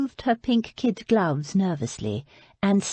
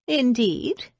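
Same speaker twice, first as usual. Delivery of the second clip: arctic tones; 6 semitones higher than usual. A narrator's reading. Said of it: "in arctic tones".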